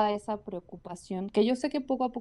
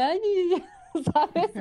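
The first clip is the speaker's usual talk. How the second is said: higher, louder, laughing through it; laughing mock reply; 9 semitones higher, 5 dB louder than usual. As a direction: mimic her own laughing reaction, a pitch up. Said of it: "Y yo".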